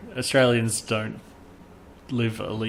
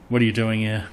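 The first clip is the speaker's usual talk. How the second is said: usual speaking voice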